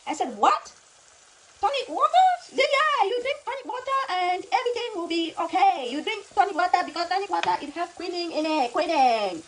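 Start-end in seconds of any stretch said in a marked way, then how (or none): 0.4-2.3 loud, high-pitched incredulous retort; baffled patient, louder and much higher; acted disbelief. Acting out her disbelief at advice. 2.5-9.4 high-pitched mock Chinese accent; imitated Chinese accent. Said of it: "making her accent".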